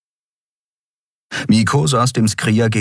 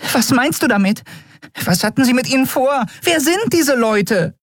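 high, rushing tiny voice, hysterical; panicked little creature firing questions; hysterical fairy. Acted. Nelen buzzing around Keru, high hysterical questions. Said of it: "umschwirrte hysterisch".

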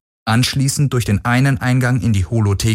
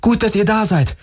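urgent, high-pitched call; 13 semitones higher than usual. Acted. Heroine to approaching guards, calling out in feigned relief. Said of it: "rief sie den Männern entgegen".

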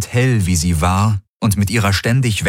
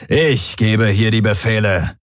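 snorting. A narrator's reading. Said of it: "schnaubte der Feldherr".

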